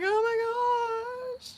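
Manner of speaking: high, long drawn-out mock wail; freaking out. Coworker freaking out over the sergeant, mock-overcome. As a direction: stretch it into a swooning squeal. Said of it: "she was freaking out".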